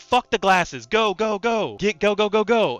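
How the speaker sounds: high, loud, insistent go go go; shouting at Randy to forget his glasses and run; frantic urging. Retold or imitated. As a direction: drive him out fast, shouting. Urging him to flee bees.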